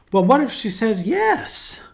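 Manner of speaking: high, slow, delighted wondering; hopeful what-if. Shown as a demonstration. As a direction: draw it out and soar with possibility. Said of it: "Same thing can be done with the two words".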